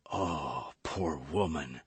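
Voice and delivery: soft, low broken murmur. Acted. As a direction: murmur with a cracking heart. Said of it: "murmured Athos in a broken voice".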